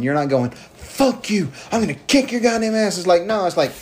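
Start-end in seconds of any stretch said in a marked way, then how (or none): 0.5-3.0 higher, yelled trash-talk threat; screaming promo. Retold or imitated. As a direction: bark it like a cliché screaming wrestler. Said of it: "not screaming and yelling".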